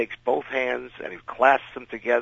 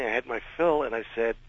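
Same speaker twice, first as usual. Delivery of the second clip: ordinary delivery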